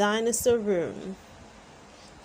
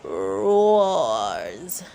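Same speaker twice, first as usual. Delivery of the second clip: toy dinosaur roar; 7 semitones lower, 6 dB louder than usual. A narrator's reading. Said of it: "George's new dinosaur roars".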